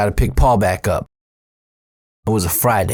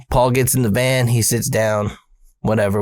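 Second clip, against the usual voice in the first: ordinary talk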